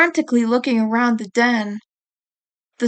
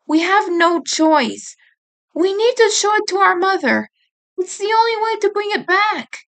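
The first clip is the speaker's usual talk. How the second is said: insisting sister; 8 semitones higher than usual. A narrator's reading. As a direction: press the point to the others. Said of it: "Tomoka insisted".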